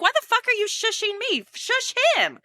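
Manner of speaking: high-pitched, indignant mock yell; hammed-up squeaky outrage. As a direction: tell off the shusher in a high voice. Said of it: "like, yells at this priest".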